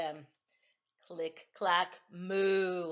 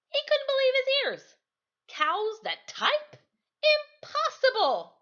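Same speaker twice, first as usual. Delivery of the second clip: much higher and louder, astonished; disbelief; 7 dB louder than usual. A narrator's reading. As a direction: gasp it out in amazement. Farmer's disbelief in story.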